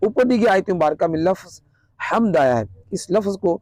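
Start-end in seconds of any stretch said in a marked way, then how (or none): none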